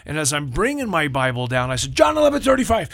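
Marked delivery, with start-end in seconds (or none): 1.9-2.9 put-on high, loud kid blurting it out; acted boy racing to answer first; eager shouted answer.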